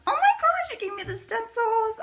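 thrilled surprise; high excited squeal. Real, not acted. Excited at stencils.

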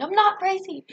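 high, loud retold outburst; hammed-up defensive shout; mock indignant yell. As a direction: squawk it in exaggerated protest. Retold protest to a sender.

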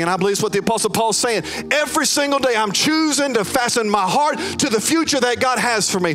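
preacher at full force over music; full preaching. Acted. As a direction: lift the room, pressing on. Preaching climax to the room.

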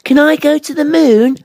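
high, loud little-bear question; high-pitched cub voice; Baby Bear. A narrator's reading. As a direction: ask eagerly like a small child. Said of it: "asked Baby Bear".

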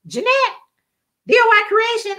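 high-pitched questioning call of a name; surprised recognition. Retold or imitated. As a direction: imitate a friend spotting someone unexpectedly. Surprised friend recognizing her in store.

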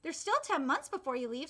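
usual speaking voice

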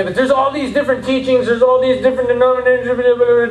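high, monotone run-on complaint; fretting objector. Acted.

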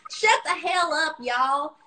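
Ruth's fake Southern twang. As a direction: drawl it like Ruth from Ozark. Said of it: "Southern twang where she's like".

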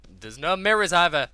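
loud, rising exclamation; excited outburst. Real, not acted. Gamer marvelling at no mirrors, excited outburst. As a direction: exclaim it loudly over the game. Ranting with glee about the game.

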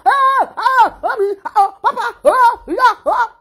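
high, loud frightened wails; imitated children screaming. Voicing small children screaming in fear during a live video. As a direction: cry out for mommy and papa in fright. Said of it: "The children go dey shout".

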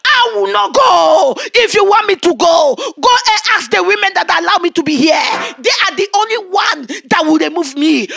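loud, high mocking refusal; haughty spirit shouting she will not go; defiant spirit woman. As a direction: throw the refusal back in his face. Spirit woman defying the preacher.

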